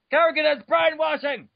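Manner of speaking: indignant shout. Real, not acted. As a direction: yell it in mock outrage. Outraged aside while reading lore.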